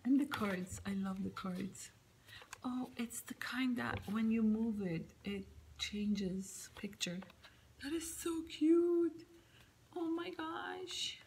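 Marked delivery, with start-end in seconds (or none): 2.6-7.2 whispering. Real, not acted. Explaining card, hushed. 7.8-9.2 much higher and louder, delighted; excited gush. Real, not acted. Gushing over card. 10.0-11.2 higher, slow hushed whisper; awed whisper. Real, not acted.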